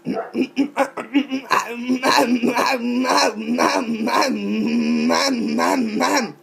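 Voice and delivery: throaty rhythmic nyam-nyam gabble; odd mouth noise. Shown as a demonstration. Showing viewers a silly sound, rhythmic throaty gabble. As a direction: repeat a nonsense syllable fast in a put-on voice. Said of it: "I'm going to do some sounds".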